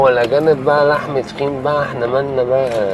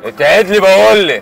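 raised, slow, forceful scolding; angry order; 5 semitones higher, 5 dB louder than usual. Acted. Husband orders wife to behave, angry order. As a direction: order her sharply in a raised voice.

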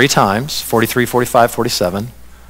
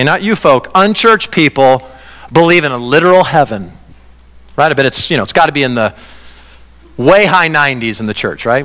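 ordinary delivery

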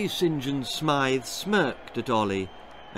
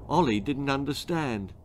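ordinary delivery

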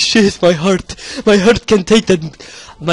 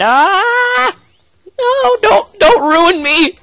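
panicked cry; 14 semitones higher, much slower than usual. Real, not acted. Render popup threatens game.

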